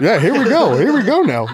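high, laughing here we go; laughing excitement. Retold or imitated. Husband egging it on, high with laughter. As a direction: cheer it on while laughing.

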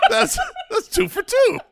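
high-pitched, helpless laughter through words; laughing. Real, not acted. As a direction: squeeze it out while cracking up. Cracking up at the name joke.

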